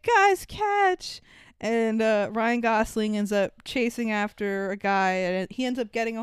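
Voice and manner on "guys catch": high call out. Retold or imitated. Holly throws the film out the window, calling out high. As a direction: toss it and shout to them. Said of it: "she's like".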